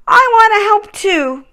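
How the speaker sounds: a small reindeer gathering courage; young Rudolph. A narrator's reading. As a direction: Rudolph deciding to help. Rudolph's voice in story.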